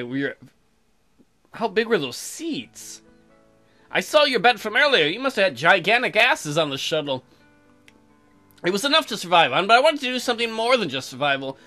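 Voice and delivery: ordinary talk, nothing marked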